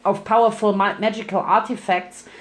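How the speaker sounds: plain reading voice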